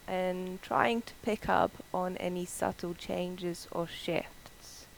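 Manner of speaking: soft whisper. Acted. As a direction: breathe the words out. Quiet meditation cue.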